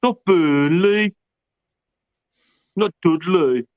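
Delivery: drawn-out, exaggerated Brummie vowels; slow mocking Birmingham accent. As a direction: take off a Brummie accent, stretching the names. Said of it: "There's nothing worse than the Brummie accent".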